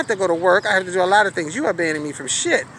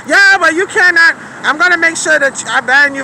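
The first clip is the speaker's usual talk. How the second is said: high, loud threat of a ban; driver's threat; 9 semitones higher, 7 dB louder than usual. Retold or imitated. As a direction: play the driver threatening her, raised and loud. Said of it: "he was trying to tell me".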